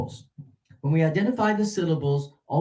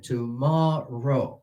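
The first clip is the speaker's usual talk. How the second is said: very slow "tomorrow", peaking on "mor"; pyramid stress; much slower than usual. Shown as a demonstration. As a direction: stretch "tomorrow" syllable by syllable, rising to "mor" and falling. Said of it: "it's a pyramid".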